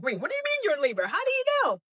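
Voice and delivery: fast, rising disbelief, a jump up at the end; quick flustered wait what; startled husband. Retold or imitated. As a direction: fire off the questions like a stunned husband. Husband startled by labor news.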